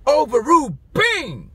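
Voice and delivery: loud, high, fired-up preaching; preaching at full force. Acted. Preacher firing up the congregation, loud and high. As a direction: preach it out with rising fire.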